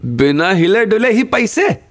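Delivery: rickshaw driver's high-pitched, amazed question; pleasantly surprised. A narrator's reading. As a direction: marvel at it in a high character voice. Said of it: "थोड़ा हैरान हुआ, फिर मुस्कुराया". (हैरान rickshaw वाले की ऊँची आवाज़)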